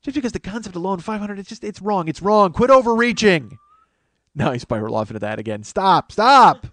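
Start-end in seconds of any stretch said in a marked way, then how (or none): 2.1-3.6 firm louder repeat; insist, louder the second time; louder insistence. Real, not acted. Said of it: "repeated louder". 6.1-6.7 loud laughing stop. Real, not acted. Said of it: "louder repeat laughing".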